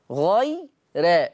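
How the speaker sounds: غي را slowly as "ghay-re"; thin ra. Shown as a demonstration. Teacher repeating Warsh's reading, را light as "re". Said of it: "يقرأ بترقيق الراء".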